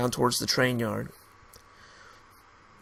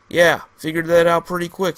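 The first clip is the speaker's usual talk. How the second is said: snarly; 4 semitones higher, 8 dB louder than usual. Acted. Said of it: "he said, snarly".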